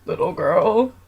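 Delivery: slow lightly voiced monster talking to a little girl; goofy monster voice. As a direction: stretch it out like a playful monster. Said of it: "I'd be like".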